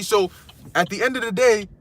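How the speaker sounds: ordinary delivery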